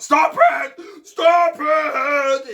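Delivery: very loud, high, stretched yell; angry shout. A narrator's reading. Bear trying to stop the animals singing, shouting. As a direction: bellow each word in a temper. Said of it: "Bear started to shout".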